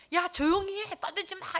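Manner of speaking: high, loud mock telling-off; scolding. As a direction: imitate an annoyed crowd hushing someone. Acting crowd scolding the blind man.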